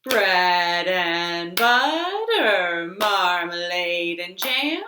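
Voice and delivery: slow, drawn-out nursery chant; singsong rhyme. Acted. Storytime host to children, slow singsong chant. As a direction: chant it slowly for little kids.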